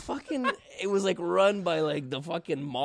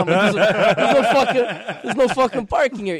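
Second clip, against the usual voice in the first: loud, exasperated retold rant; venting at the parking chaos, half laughing; frustrated yell; 8 semitones higher, 12 dB louder than usual. Retold rant about Naples parking.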